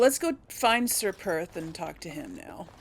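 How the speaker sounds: usual speaking voice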